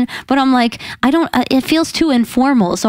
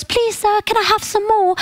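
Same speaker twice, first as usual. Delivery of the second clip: pleading Oliver; 9 semitones higher than usual. Retold or imitated. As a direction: ask sweetly and timidly for more food. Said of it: "That's why he goes".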